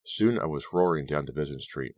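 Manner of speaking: ordinary delivery, nothing marked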